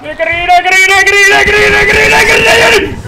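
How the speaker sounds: high chant climbing in pitch; loud, escalating shrieked repetition; frantic repeated yell. Real, not acted. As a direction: build to a screamed peak.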